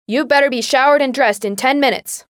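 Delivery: loud, high warning call; stern yell. A narrator's reading. Mother warns daughter through the door, stern yell. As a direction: warn her through the door.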